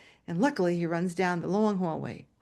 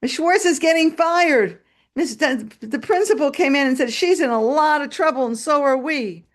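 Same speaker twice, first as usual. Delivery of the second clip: put-on higher, louder tattling child; worried kid; 8 semitones higher, 5 dB louder than usual. Classmate telling Jimmy the teacher is in trouble, high kid voice. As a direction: blurt out the bad news. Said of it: "one of the kids says".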